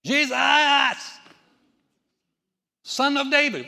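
high, loud desperate call; beggar yelling. Retold or imitated. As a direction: call out to Jesus across the crowd. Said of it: "he got louder".

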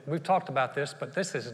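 normal talking voice

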